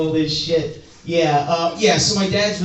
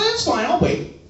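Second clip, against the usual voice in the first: put-on high teen voice; 11 semitones higher than usual. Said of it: "He's like".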